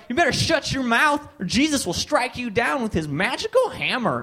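high-pitched zealot's warning; hammed-up righteous warning; mock threatening bigot. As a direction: imitate a worked-up bigot laying down a threat.

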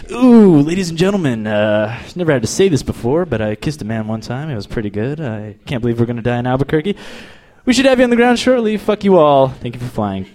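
mock-flustered announcement, pitch jumping about; rattled pilot imitation. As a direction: play a pilot losing his cool on the intercom. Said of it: "That's what you don't wanna hear".

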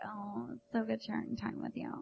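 mock tender whisper; hushed pretend pillow talk. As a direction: whisper it sweetly to a lover. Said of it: "and you're like".

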